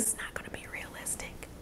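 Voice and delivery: soft unvoiced aside whisper. Real, not acted. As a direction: drop to a secret whisper mid-thought.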